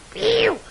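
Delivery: a loud, squeaky sigh of relief; high relieved phew. Acted. Relief after the scare.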